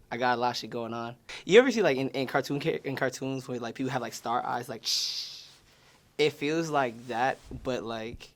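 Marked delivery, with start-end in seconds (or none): 4.8-5.4 voiceless "shh" sound effect; imitated whoosh. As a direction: imitate a cartoon star-eyes whoosh. Imitating cartoon star eyes.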